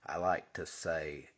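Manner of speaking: usual speaking voice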